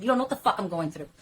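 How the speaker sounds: ordinary talk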